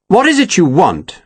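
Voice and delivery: mildly sharp, impatient question; a little tart, losing patience; a touch of asperity. A narrator's reading. Colonel to his tiresome captain, a touch sharp. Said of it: "Lawford asked with a touch of asperity".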